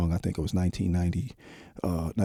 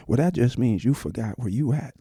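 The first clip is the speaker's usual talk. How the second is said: usual speaking voice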